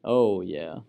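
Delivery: ordinary delivery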